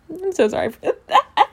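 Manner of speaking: real laughter breaking into the words; laughing apology. Real, not acted. Reader apologises for their own fake laugh, laughing.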